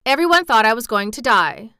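put-on loud, short statement from a boy; brusque declaration; curt. A narrator's reading. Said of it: "said Colin shortly".

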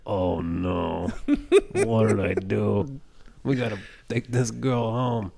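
slow, lightly voiced dismay; a touch of hammed-up alarm; mock panic. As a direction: act out the sudden realisation. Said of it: "then the light comes on".

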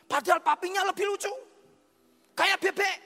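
loud, high, excited retelling; impassioned sermon storytelling. Real, not acted. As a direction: exclaim the story to the whole congregation.

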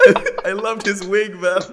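high, loud laughter into the words; laughing. Real, not acted. Friends mocking a wig, laughing hard. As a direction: crack up while speaking.

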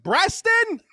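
high, loud barista call across the shop; calling out a name. Retold or imitated. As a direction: call the name out over the counter.

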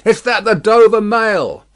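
insistent high, loud hail; distant traveller calling out; calling from afar. A narrator's reading. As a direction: shout the question through mist. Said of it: "a man's voice called from the mist".

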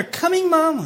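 put-on child answering mom while murmuring; grudging child. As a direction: answer Mom as a reluctant kid. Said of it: "your children murmur back to you".

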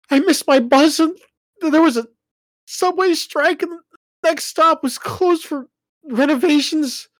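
high, loud, tearful rushing; explaining through tears to parents; tearful explanation. Acted.

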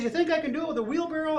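high, rapid question; showman's challenge. Acted. Tightrope walker raising the stakes for his crowd, excited challenge. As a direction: egg the crowd on quickly. Acts Blondin rallying the crowd.

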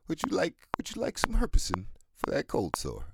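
high, bright-pitched mock-helpful offer to a stranger; cheeky retold offer. Retelling a cheeky remark to a stranger, mock-helpful and high. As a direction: pitch it up like a salesman. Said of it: "I actually said that to somebody".

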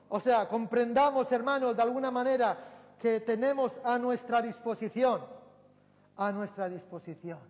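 loud, high, ringing sermon delivery; fiery pulpit preaching; full-force preaching. Acted. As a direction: preach it out to the whole church.

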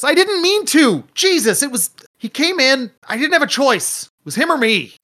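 high-pitched panicked excuse; desperate pleading. Acted. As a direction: plead for your life, high and loud at first. Deck pleads at sword point.

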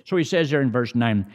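ordinary talk

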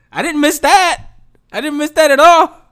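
nope, not missed at all, said up high and loud; real exasperated declaration; emphatic outburst. Real, not acted. Emphatic complaint about sports stress.

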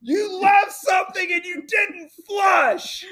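wife accusing him of not flushing; imitating an accusation. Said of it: "She said".

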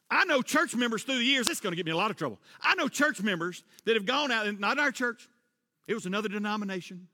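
ordinary delivery

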